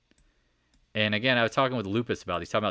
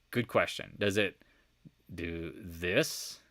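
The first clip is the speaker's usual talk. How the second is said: ordinary delivery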